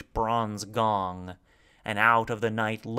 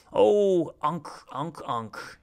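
mock commiseration; 6 semitones higher, 3 dB louder, much slower than usual. A narrator's reading. Man with hand on Unc's shoulder, fading commiseration.

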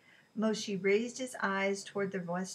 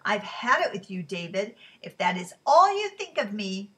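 loud, furious break with him; fed-up anger; 11 dB louder than usual. Acted. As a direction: let all the anger out at once. Quarrel in a restaurant.

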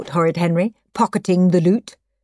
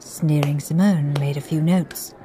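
ordinary delivery, nothing marked

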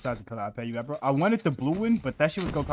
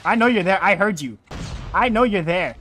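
loud taunting call, much higher and louder; 7 semitones higher, 11 dB louder than usual. Real, not acted. Streamer taunting a hiding enemy, loud shout. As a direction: shout it at the enemy you can't see.

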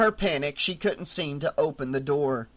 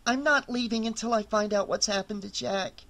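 quivering voice; 6 semitones higher than usual. A narrator's reading. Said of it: "her voice quivering".

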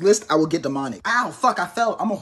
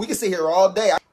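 ordinary talk

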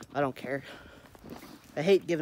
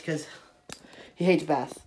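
ordinary delivery

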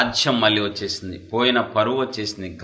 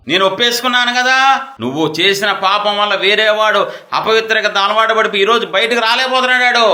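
mock excuse; 9 semitones higher, 5 dB louder than usual. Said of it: "mocking a sinner's excuse".

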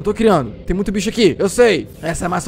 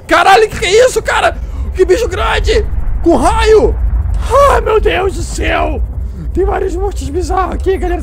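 loud, high startled yelling; shocked shout; 10 semitones higher, 5 dB louder than usual. Real, not acted. Gamer startled by a giant creature, loud high shocked yells. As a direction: blurt it out in shock. Huge creature appears suddenly.

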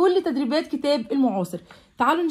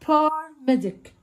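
slow textbook reading; 3 semitones higher, much slower than usual. Shown as a demonstration. Teacher reading the lesson text aloud to pupils, slow and clear. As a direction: read each English phrase out slowly for the class.

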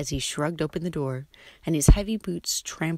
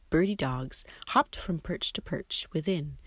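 usual speaking voice

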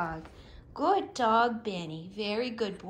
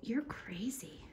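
slow, hushed whispered aside; 12 dB quieter, slower than usual. Real, not acted. Owner whispering about her dog, hushed. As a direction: breathe it out softly, under the breath. Aside while dogs walk.

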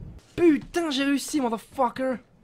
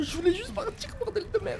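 panicked; 12 semitones higher, 5 dB quieter than usual. Real, not acted. Panicking as monster attacks.

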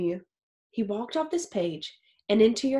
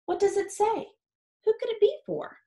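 wondering questions; 11 semitones higher than usual. A narrator's reading.

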